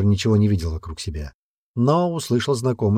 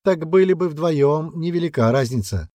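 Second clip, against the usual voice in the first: high, drawn-out mocking taunt; gloating jeer; 8 semitones higher than usual. A narrator's reading. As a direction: rub it in gleefully, higher and slower. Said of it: "заржал Мерих".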